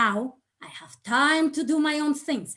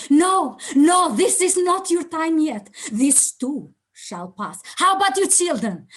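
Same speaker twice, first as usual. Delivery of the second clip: higher, louder, commanding inner voice; powerful inner voice; 6 semitones higher, 6 dB louder than usual. Acted. Her inner voice tells the dying mother it is not her time, powerful and commanding. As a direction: speak as the strong voice inside that refuses her death. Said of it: "this powerful inner voice said to me".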